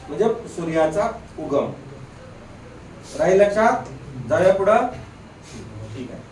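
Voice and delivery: normal talking voice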